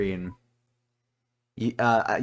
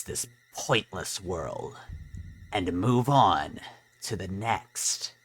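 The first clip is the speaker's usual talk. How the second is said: low, slow and menacing; ominous Chara; 3 semitones lower, 6 dB quieter, much slower than usual. Acted. Chara tells the player why they returned. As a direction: draw each phrase out like a cold threat.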